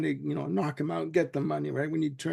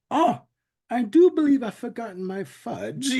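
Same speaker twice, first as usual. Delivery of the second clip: prissy mock mother; 6 semitones higher, 4 dB louder than usual. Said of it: "He's like".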